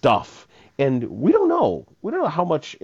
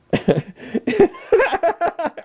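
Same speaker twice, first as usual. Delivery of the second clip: big laugh. Real, not acted. Host cracks up at caller.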